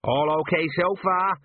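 high, drawn-out call. A narrator's reading. Gnome falling past the sergeant, calling out cheerily. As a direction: call it out slowly while dropping past. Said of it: "he shouted as he went past".

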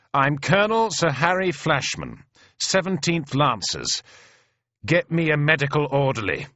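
high, ringing officer's announcement; commanding. Acted. Wounded colonel announcing himself to the soldiers, commanding. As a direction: pull rank and give the order.

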